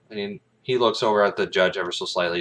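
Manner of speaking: ordinary delivery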